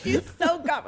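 high, helpless laughing voice; laughing hard. Real, not acted. Guest admitting co-host got her, laughing. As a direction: squeal it through real laughter. Realises she was tricked.

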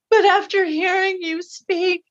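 high voice of someone overcome, lightly acted; retold tearful sobbing. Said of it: "started streaming tears and sobbing".

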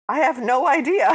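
loud, high mock denial; clueless Cinderella. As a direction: belt it out as an overacted Cinderella. Joke version of Cinderella's coy answer.

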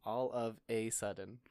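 slowly, "a" pronounced like the letter A. Shown as a demonstration. Answering which phrase is right, "a" as "ay". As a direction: say "a" as "ay", drawn out. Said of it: "a is the same word".

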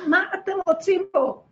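a loud, high, angry yell down the phone; angry shouting. Retold or imitated. Yelling at the woman on the phone, angry and loud. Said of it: "צריכה לצעוק".

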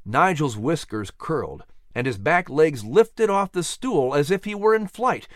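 plain reading voice

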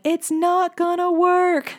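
put-on high, drawn-out little-kid protest; whiny child. Big sister refusing to help at bedtime, whiny mock protest. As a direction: imitate a ticked-off big sister, high and slow.

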